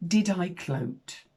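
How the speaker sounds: ordinary delivery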